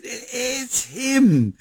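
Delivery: high-pitched, awestruck imitation of the wife; stunned announcement. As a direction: act out her disbelief, pitch leaping up high. Wife announces stunning caller, pointing phone.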